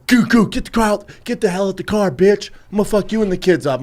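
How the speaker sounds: retold aggressive shouting at a driver; angry yelling. Acting out a road-rage man yelling.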